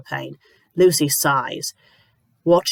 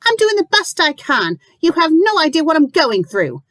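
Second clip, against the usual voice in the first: high loud wounded protest; upset outcry; 12 semitones higher, 4 dB louder than usual. Acted. Lucy defending herself to the landlady, high hurt outcry. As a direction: fight back, hurt.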